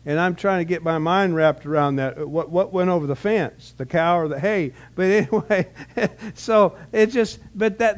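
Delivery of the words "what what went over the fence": usual speaking voice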